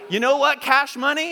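loud, high challenge; a little attitude. Shown as a demonstration. Preacher confronting cash money before the congregation, with attitude. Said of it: "say it with a little attitude".

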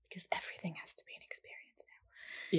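hushed, whispered wry aside. Real, not acted. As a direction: drop into a whisper for a side comment. Wry aside while reading the label.

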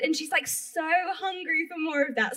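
usual speaking voice

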